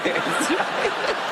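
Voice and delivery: a high, squeaky giggle breaking out; imitating a high giggle. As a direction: mimic a brother who can't stop giggling. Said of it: "Larry eventually sort of started doing this".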